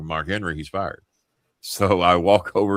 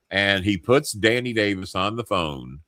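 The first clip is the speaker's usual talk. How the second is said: usual speaking voice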